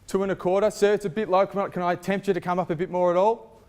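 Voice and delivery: rally the bidders, full voice; auctioneer. Acted. Calling for bids at auction.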